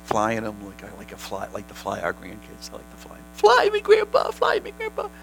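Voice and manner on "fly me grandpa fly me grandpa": put-on high childlike pleading voice; squeaky child begging grandpa; little kid voice.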